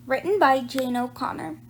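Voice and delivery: ordinary delivery, nothing marked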